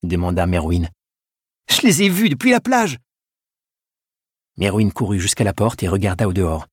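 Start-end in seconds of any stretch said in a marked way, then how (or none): 1.7-3.0 high-pitched alarmed exclamation; urgent insistence. A narrator's reading. Edgar telling a doubting monk what he saw, urgent.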